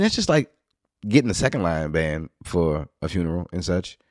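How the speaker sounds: normal talking voice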